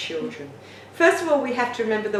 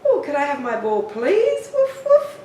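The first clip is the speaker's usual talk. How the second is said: polite dog; 6 semitones higher, 4 dB louder than usual. Pretend. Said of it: "the dog learns to go".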